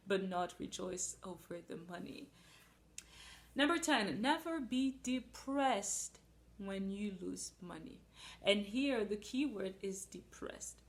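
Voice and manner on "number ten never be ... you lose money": loud, high announcement, slowing on the rule; preaching a rule. Acted. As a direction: proclaim it slowly. Announcing rule number ten.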